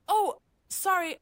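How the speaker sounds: sing-song overpolite apology. Retold or imitated. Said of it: "my voice up ten octaves".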